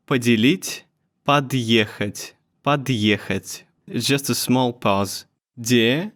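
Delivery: ordinary delivery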